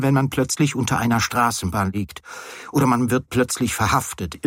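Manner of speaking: ordinary delivery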